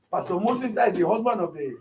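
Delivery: normal talking voice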